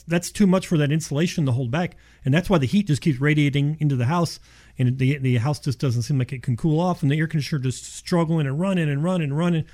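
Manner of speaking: usual speaking voice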